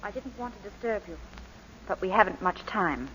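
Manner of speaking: hushed apology from the wife; hesitant, gentle. Acted. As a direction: interrupt timidly and softly. Wife interrupts timidly.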